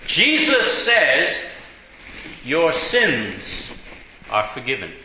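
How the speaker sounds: phrase by phrase for someone not getting it; slow, emphatic repetition. Retold or imitated. Spells it out for puzzled driver.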